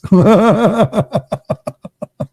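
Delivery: high, rapid staccato cackle; long high laugh that tails off. Real, not acted. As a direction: laugh in a fast pulsing run, high up. Laughing at own mystery joke.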